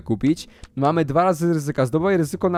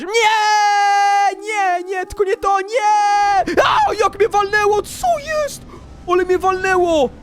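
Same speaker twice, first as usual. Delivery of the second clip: long, very high wail; real high-pitched gamer scream; screamed despair; much slower than usual. Real, not acted. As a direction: scream in despair as you get hit. Wave hits near the finish.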